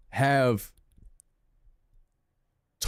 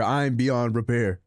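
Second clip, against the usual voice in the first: mock despair; 3 semitones lower, slower than usual. Melodramatic self-pity.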